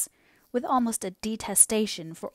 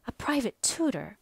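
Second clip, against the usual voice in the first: scornful; 4 semitones higher than usual. Acted. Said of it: "looking scornful".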